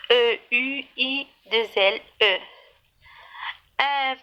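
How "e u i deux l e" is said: ordinary talk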